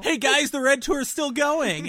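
high, loud, chirpy announcement; excited announcement. Pretend. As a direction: shout it out like a gleeful tour promo. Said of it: "mock announcement, high and loud".

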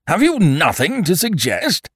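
high, loud, exasperated question; impatient. A narrator's reading. King demanding suggestions from the jester, high and impatient. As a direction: demand an answer, pitched high. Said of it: "said the king impatiently".

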